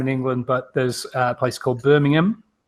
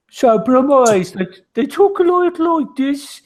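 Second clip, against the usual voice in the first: Brummie accent; 10 semitones higher, 4 dB louder than usual. Shown as a demonstration. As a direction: put on a thick Brummie twang. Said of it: "can we have a sample?".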